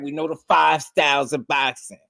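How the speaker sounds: high, earnest mock boast; imitating a bro's earnest boast; imitating his bro. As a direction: boast like a true believer. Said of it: "what my bro be saying".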